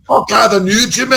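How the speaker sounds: high, forced, harsh Scots; straining, hammed-up Scottish challenge; harsh Scottish accent. Shown as a demonstration. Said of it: "one sounds very harsh".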